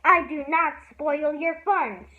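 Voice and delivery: loud, slow, angry protest; stormy. A narrator's reading. Ramona storming at Beezus, loud and slow. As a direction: storm at her sister. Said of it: "stormed Ramona".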